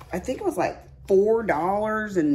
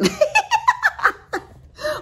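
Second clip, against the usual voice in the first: real high-pitched belly laugh; high cackling laugh; 8 dB louder than usual. Real, not acted. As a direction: burst of high, loud laughter. Laughs at her own joke.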